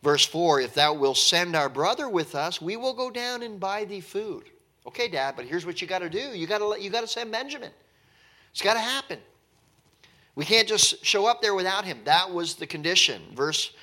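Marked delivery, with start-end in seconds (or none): 0.6-4.5 raised, formal reading of the verse; scripture reading. Acted. 4.9-12.0 higher, urgent, quicker plea; brothers pleading with Dad. Retold or imitated. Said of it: "brothers voiced to Dad".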